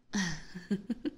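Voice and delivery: breathy teasing chuckle. Acted. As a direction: chuckle at the prisoner's plight. Vampire amused by her captive.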